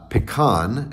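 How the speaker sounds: "pecan" as puh-KAHN, stress on the end; southern pronunciation. Shown as a demonstration. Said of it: "as in the southern pronunciation".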